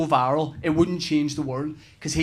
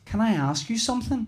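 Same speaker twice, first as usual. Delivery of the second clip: put-on young daughter's question; 5 semitones higher, 6 dB quieter than usual. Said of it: "and she said to me".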